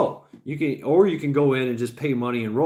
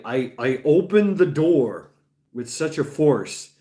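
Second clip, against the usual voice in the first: ordinary delivery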